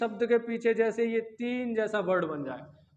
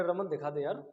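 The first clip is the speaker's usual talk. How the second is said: softer, lower plea to a friend; exam-cheating hush; 6 semitones lower, 7 dB quieter than usual. Pretend. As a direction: sneak the request out during a test. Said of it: "Raman से तुम्हें cheating लेनी है".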